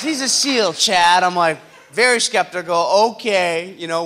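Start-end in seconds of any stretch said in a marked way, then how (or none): 0.0-1.2 louder, higher dad insisting; dad pressing the point loudly; exasperated insisting. Retold or imitated. Dad insisting to his doubtful son, louder.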